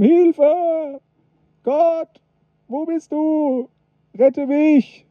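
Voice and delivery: high, desperate calls of a man hanging from a cliff; cry for help. Acted. Fallen hiker calling out to God, high and panicked. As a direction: plead for rescue in a high panicked voice. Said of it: "und hat gerufen".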